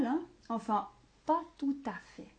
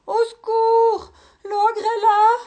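much higher, loud panicked scream; woman crying for help; 12 semitones higher, 11 dB louder than usual. Acted. Woman screaming at the ogre, high and terrified. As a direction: shriek in terror. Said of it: "cria une femme".

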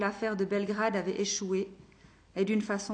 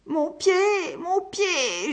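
high, loud pained moan of a hurt man; desperate groaning plea; 9 semitones higher, 7 dB louder, much slower than usual. Acted. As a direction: cry out about the foot in pain. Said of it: "gémit-il".

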